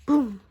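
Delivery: sharp gasp then a loud boom; gasp, then shout the boom. A narrator's reading. Gasp and boom sound effect.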